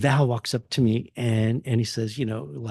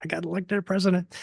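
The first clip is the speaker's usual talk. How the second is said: high giddy disbelief; playful mock excitement, pitched up; excited self-quote; 7 semitones higher, faster than usual. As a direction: gush like you can't believe your luck. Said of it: "I was all like".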